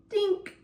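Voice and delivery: mock dink sound. Stick drops on fox's noggin.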